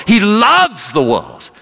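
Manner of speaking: high, slow, impassioned preacher's cry; street preaching. Retold or imitated. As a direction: stretch it out like a street evangelist. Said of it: "He's preaching like this on the streets".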